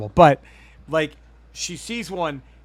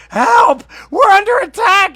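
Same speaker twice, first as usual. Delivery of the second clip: high panicked shout; alien cry for help; 17 dB louder than usual. Retold or imitated. Retelling the movie's alien distress call, shrill shout. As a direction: yell for help like a trapped alien. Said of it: "you just hear a voice go".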